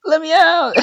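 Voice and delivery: high-pitched mock desperate wail; acting out a trapped kid; trapped pleading. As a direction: plead to be freed, hammed up. Trapped child banging on doors.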